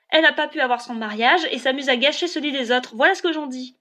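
high, loud bitter accusation; angry outburst. A narrator's reading. Classmate accusing Elinor of spoiling the wedding, angry.

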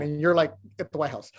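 usual speaking voice

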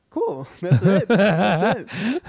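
laughing through the words. Retold or imitated. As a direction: chuckle it out, amused. Laughing reaction to dishes.

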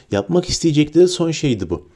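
usual speaking voice

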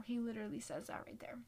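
quick whispered aside. Real, not acted. Pointing the viewer to the verse, fast whisper. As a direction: rush it out in a whisper.

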